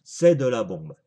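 said again with a burst of volume; loud excited repeat. Real, not acted. Vaper repeats praise, much louder.

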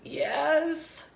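odd little yes; snake voice. Acted. Snake agrees with Piggy, chirping. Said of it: "the snake says".

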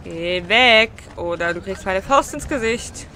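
loud threatening yell; angry shout. Real, not acted. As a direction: shout them away, furious. Threatening an annoying beggar.

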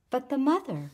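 put-on a little girl who won't let it go; small persistent voice. A narrator's reading. Felicia pushing the woman to go on, small persistent child voice. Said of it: "in the small, persistent voice".

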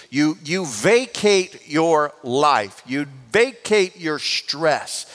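slow, deliberate preaching on vacate; slow emphasis. Acted. Pastor explains vacation as emptying, slow and deliberate. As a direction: draw out vacate your life, calm and slow.

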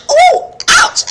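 high, loud "ooh, ouch"; retold yelp of pain; mock hollering. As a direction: yelp like a child getting spanked. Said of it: "I'd be hollering and screaming".

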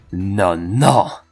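a gleeful, lightly voiced Jeff; amused killer. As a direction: relish finding a real opponent. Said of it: "stwierdził". (z rozbawieniem, zadowolony morderca)

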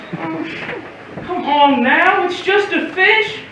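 high, cajoling reassurance; jolly a crying child out of it; coaxing. Acted.

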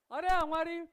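normal talking voice

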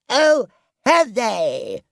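high, loud, drawn-out challenge; indignant. A narrator's reading. Toad hears squatters mean to stay, indignant. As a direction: flare up, stretching the words high. Toad angered, seizes a stick.

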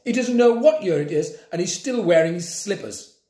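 mock him to the children; scornful teacher aside. A narrator's reading. Teacher sneering at Grandpa to the class.

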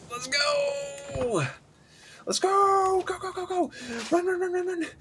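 high, shrill-pitched yelling to hurry the troops; urging the men on at the top of his voice; frantic cheering on. Real, not acted. Gamer yelling at his troops to run, frantic high cheer.

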